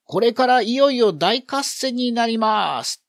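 high, loud shouted announcement; 叫ぶ口上. A narrator's reading. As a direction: shout it out to the whole crowd, pitched high. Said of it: "三公が声を上げて叫んでいる". (声を高く張り上げた子供の口上)

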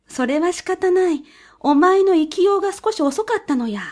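high, sly weasel voice. A narrator's reading.

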